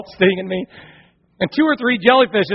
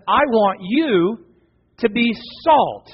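slow God quote; 2 semitones higher, much slower than usual. Acted. Quoting God, slow emphasis.

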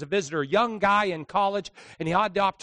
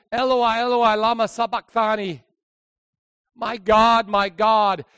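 anguished cry; 5 semitones higher, 9 dB louder than usual. Acted. Quoting Jesus' last cry.

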